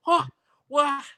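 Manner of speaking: startled high huh, what; taken aback. Retold or imitated. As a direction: caught off guard, pitch jumping up. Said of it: "so taken aback".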